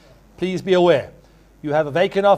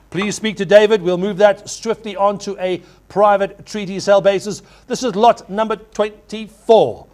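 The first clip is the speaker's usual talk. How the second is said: ordinary delivery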